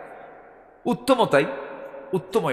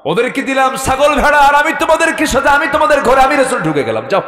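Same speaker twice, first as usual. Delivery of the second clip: high, loud, impassioned declaration; fiery oration; 12 semitones higher, 8 dB louder than usual. Acted. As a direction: ring it out over the crowd. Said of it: "তেজোদ্দীপ্ত একটা ভাষণ দিলেন". (তেজোদ্দীপ্ত চড়া গলায় ভাষণ)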